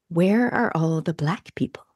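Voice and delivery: slow hushed whisper, almost secretive. Retold or imitated. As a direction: whisper it like a shocked secret question. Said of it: "this was my first reaction".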